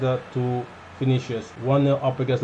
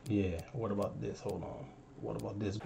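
very soft, low to himself; muttering; 7 semitones lower, 18 dB quieter than usual. Real, not acted. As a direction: mutter while thinking. Trying something, muttering.

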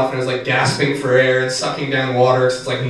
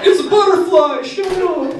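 high mock voice. Mocking friend in retold quote.

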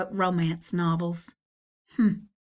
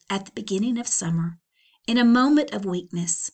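ordinary delivery, nothing marked

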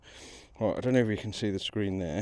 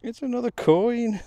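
loud, high, drawn-out jubilant cry; excited discovery; 13 semitones higher, 8 dB louder, slower than usual. Real, not acted. Detectorist repeating news of another coin to viewers, excited. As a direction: crow it high and loud, stretching the words.

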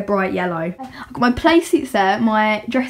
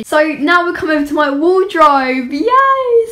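excited; 6 semitones higher, 6 dB louder than usual. Real, not acted. Cheerful wardrobe tour reveal.

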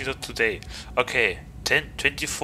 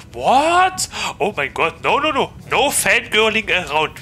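shocked shriek; 8 semitones higher, 6 dB louder than usual. Real, not acted. Reacting to flirty chat.

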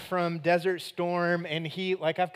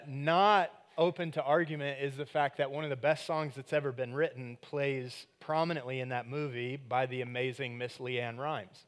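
ordinary delivery

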